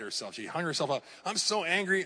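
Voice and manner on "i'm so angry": higher, a bit louder imitation of an upset churchgoer; mock angry. Pastor imitates upset churchgoer about [name], mock angry. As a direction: play the offended member lightly. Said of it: "they were so upset".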